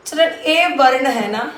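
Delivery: usual speaking voice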